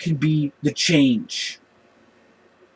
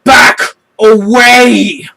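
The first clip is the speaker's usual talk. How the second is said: much higher, much louder with restraint; angry warning shout; 7 semitones higher, 12 dB louder, much slower than usual. Retold or imitated. B'Elanna warns the worker with a restrained angry shout. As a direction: bark it out, holding back the anger. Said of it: "And she's like".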